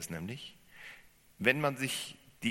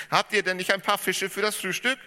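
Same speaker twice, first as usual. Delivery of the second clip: rufen; 13 semitones higher, 9 dB louder than usual. Acted. Jesus calls to the boat.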